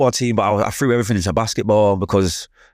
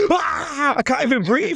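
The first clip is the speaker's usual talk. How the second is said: much higher, strained mock gasp; gasping kid; slower than usual. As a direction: play a scared child struggling for air. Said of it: "But I'm like".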